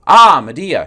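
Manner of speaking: big booming showman hail of the name; a loud, high announcer cry; loud stage greeting. Acted. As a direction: belt the name out like a master of ceremonies.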